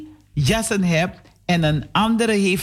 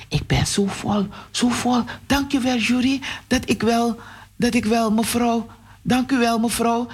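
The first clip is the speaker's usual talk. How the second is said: higher, lightly voiced girl; grateful girl; 5 semitones higher than usual. Full girl thanks the mother in a higher voice. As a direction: thank her sweetly.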